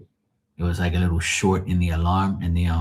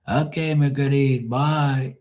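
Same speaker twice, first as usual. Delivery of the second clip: grandma's voice; 6 semitones higher than usual. Retold or imitated.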